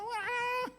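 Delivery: high imitated wail of a scolding; fierce woman ranting. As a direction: mimic her shrieking in one long high wah. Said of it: "paling galak tuh yang perempuannya". (tiruan omelan perempuan galak)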